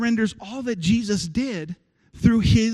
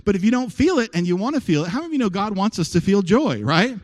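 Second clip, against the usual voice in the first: ordinary talk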